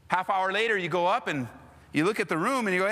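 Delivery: usual speaking voice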